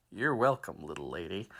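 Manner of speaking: lightly voiced gunslinger, dropping low and soft; cowboy hero. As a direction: sign off like a cool cowboy.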